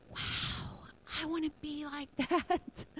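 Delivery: hushed, nearly whispered wow, then soft wishing; awed. Retold or imitated. Speaker reacting to the missionary's faith, hushed awe. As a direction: breathe it out softly in retold awe.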